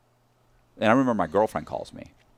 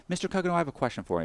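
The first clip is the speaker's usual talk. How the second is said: quick, raised, polite teller voice; bank teller; 7 semitones higher, 5 dB quieter, faster than usual. Retold or imitated. Suspicious teller questions him, quick and polite. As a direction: rattle it off like a suspicious clerk. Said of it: "He goes".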